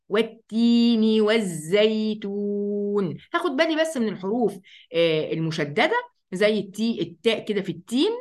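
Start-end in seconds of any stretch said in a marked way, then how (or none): 0.1-3.2 والتين والزيتون drawn out slowly, chanted; slow recitation. Shown as a demonstration. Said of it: "إحنا هنقرأها إزاي؟".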